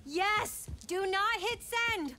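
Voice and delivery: high, loud, slow alarm; panicked shout. Real, not acted. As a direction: stop them before they post. Stopping a bad post.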